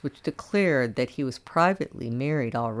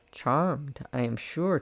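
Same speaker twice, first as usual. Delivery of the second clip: ordinary delivery, nothing marked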